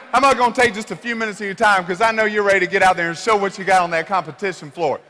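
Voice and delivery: ordinary talk, nothing marked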